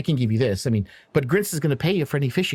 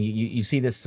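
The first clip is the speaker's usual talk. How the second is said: ordinary talk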